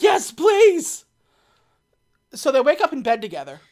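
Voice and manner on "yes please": loud, high-pitched mock begging; hammed-up desperate yes; desperate pleading. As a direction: beg eagerly at full volume. Acting H begging eagerly.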